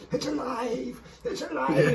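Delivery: high, drawn-out "it's alive" cry; mock horror-film cry. Joking about the gurgling chilli pot, mock horror-film cry. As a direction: cry out like a mad film scientist.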